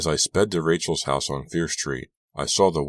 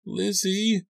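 put-on much higher, tearful girl's voice; weeping girl. A narrator's reading. Crying friend on the phone, much higher weeping. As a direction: say her name through tears. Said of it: "a girl's voice wept".

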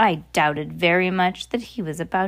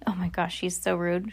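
ordinary delivery, nothing marked

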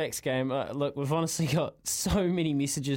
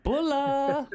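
Bula called out high and loud; cheery high call to the mate in Fiji; bright greeting call; 12 semitones higher, 3 dB louder than usual. Real, not acted. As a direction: sing out the Fijian hello.

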